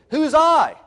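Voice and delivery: loud, high rhetorical cry; preacher's loud rhetorical challenge; emphatic question. Real, not acted. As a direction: fire the question at them.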